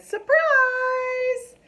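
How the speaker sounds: high, loud surprise shout; excited reveal. Acted. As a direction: pop it out with delight.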